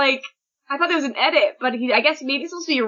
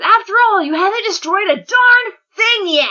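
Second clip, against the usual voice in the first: high, loud, dramatic urging; rousing outburst; 10 semitones higher, 3 dB louder than usual. Acted. Spinner urging Shigaraki to go destroy, rousing outburst. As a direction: build to a ringing high exclamation. Acted rousing cry to the leader.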